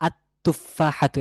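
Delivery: "আত-তুফাহাতু" very slow, lam merged into a doubled t; silent lam. Shown as a demonstration. Teacher showing the definite apple, "আত-তুফাহাতু" with lam silent. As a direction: say "আত-তুফাহাতু" slowly, holding the t. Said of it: "লামটি এখানে উচ্চারণে আসবে না".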